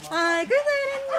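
high excited praise to a pet. Real, not acted. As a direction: gush over the good dog. Praising dog.

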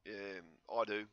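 ordinary talk, nothing marked

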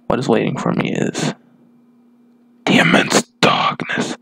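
low unvoiced whispery dark narrator; dark gritty voice. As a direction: growl it out like a brooding antihero. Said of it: "I'm gonna stop doing that dark voice".

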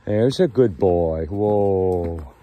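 very loud, slow, drawn-out calls; calming horse call. Real, not acted. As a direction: belt out steady, falling calls to settle a horse.

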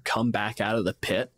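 normal talking voice

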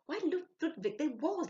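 high, loud realization; surprised. A narrator's reading. Sylvia realising to Ludwig, high and loud. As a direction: it suddenly clicks.

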